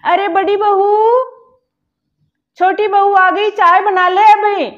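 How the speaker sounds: calling out. Acted. Mother-in-law summons elder daughter-in-law, loud call. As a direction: shout the order to the other room.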